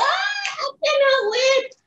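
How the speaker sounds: high, slow, excited shout. Real, not acted. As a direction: cry it out with glee. Excited about Christmas.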